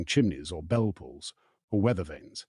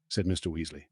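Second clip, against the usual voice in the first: plain reading voice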